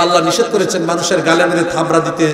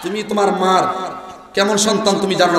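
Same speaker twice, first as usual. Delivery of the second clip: usual speaking voice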